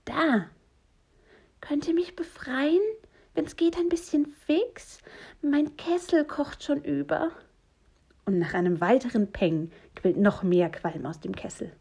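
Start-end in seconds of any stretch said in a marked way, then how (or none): 0.0-7.3 high-pitched plea for help; high little character voice, politely urgent; trapped stranger's voice. Acted. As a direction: call for rescue in a high voice. Trapped voice asks to be freed.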